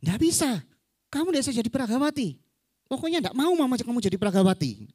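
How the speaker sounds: high-pitched indignant refusal; angry mother. Acted. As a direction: forbid it as a cross mum. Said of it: "mamanya marah". (ibu marah melarang dengan nada tinggi)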